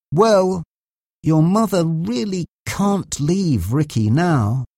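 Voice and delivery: slow, measured doctor's reply; said slowly. A narrator's reading. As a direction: draw it out with hesitant pauses. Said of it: "Doctor Greenway said slowly".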